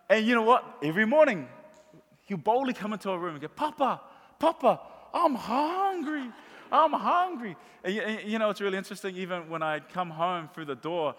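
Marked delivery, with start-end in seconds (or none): 3.5-7.5 put-on higher, slow little-boy plea; high drawn-out child's voice; grandson pleading. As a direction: whine it like a hungry grandkid. Grandson voiced asking for food.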